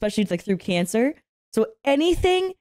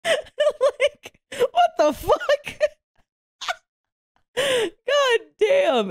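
laughing; 13 semitones higher, much slower than usual. Real, not acted. Laughing at mom's answer.

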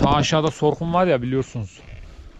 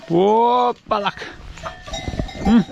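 cooing lift; 6 semitones higher, much slower than usual. Real, not acted. Lifting the kid.

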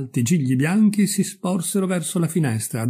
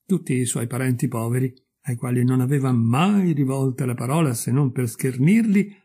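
ordinary delivery

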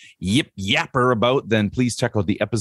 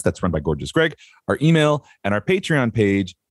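ordinary talk

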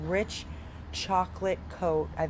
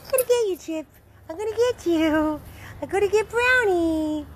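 playful high squeal, loud and teasing; high-pitched playful; 11 semitones higher, 4 dB louder than usual. Real, not acted. Owner playfully chasing her dogs in a high squeal. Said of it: "When I talk all high pitch".

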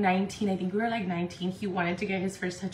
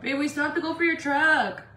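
higher-pitched call to a partner; raised, carrying voice; 6 semitones higher, 4 dB louder than usual. Real, not acted. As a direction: call over to your babe with a reminder. Calls out to partner.

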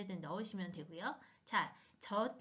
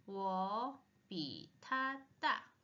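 slow drill; 3 semitones lower, much slower than usual. Shown as a demonstration. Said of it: "slow model reading".

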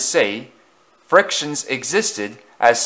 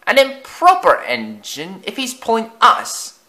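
much higher, far-off fussy voice; prim old coach; 11 semitones higher, 4 dB louder than usual. A narrator's reading. Lead coach sniffing at Afton, prim. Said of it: "said another".

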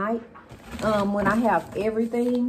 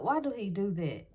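normal talking voice

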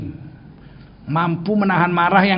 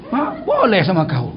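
ordinary talk, nothing marked

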